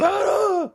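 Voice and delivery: high yelp of pain; injured man yelps as nail is pulled; pained cry. Retold or imitated. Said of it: "He goes".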